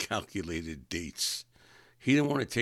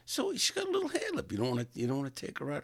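fast, high disbelieving question; incredulous; 7 semitones higher, faster than usual. Retold or imitated. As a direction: rattle it off in disbelief. Arguing with friend.

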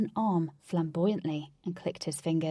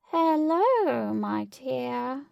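high, drawn-out eerie greeting; muffled voice; 6 semitones higher, 4 dB louder, much slower than usual. A narrator's reading. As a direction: call out high and loud, then drop on the dear. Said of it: "said a muffled voice".

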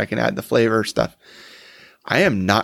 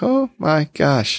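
startled gasp; 5 semitones higher, much slower than usual. Retold or imitated. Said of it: "I was like".

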